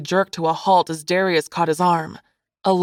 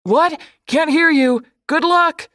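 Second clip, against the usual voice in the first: loud, high-pitched distant shout; shouting back; 10 semitones higher, 5 dB louder than usual. A narrator's reading. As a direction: holler as if out of earshot. Shouting back across the beach.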